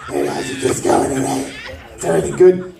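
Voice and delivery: low, rough chain-smoker aunt; a smoker aunt's low rasp; raspy smoker aunt voice. Retold or imitated. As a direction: croak it like a lifelong smoker. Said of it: "That's how she talked".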